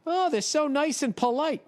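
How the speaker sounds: high-pitched mock gushing; mocking imitation. Mockingly quotes what everybody thinks.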